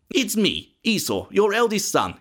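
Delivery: lightly voiced impostor son; lying younger son. A narrator's reading. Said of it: "he lied".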